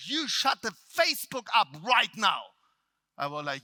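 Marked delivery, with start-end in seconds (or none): 0.1-2.4 loud, high-pitched command to be silent; angry yell. Retold or imitated. Trainer cutting off the speaker's reply, angry yell. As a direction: shut them down with a yell. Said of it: "He yells at me".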